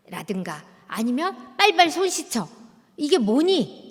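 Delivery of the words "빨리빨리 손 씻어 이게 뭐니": high, loud scolding mom; nagging mother. Retold or imitated. Mother scolding her child the moment he walks in, high and loud. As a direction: snap at the kid to wash up, high and loud. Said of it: "엄마가 아이를 딱 보자마자".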